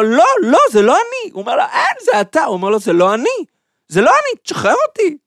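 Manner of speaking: mock bickering. Giant and joker bickering, laughing.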